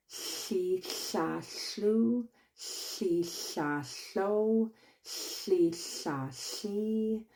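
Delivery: she, sha, shlu, shlo sung out as separate drill syllables; tongue warm-up syllables. Shown as a demonstration. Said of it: "beginning of each class".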